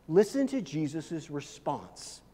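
usual speaking voice

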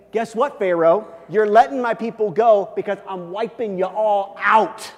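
acted divine ultimatum, punched out loudly; forceful threat. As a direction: lay down the law to a king. God's imagined threat to Pharaoh.

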